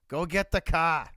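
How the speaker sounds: hammed-up Boston accent, car without its R. Shown as a demonstration. Streamer teaching chat to drop R's, Boston accent. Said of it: "We don't use the letter R here".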